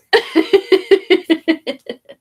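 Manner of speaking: high, loud real laughter. Real, not acted. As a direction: crack up at the joke. Laughs at daughter's joke.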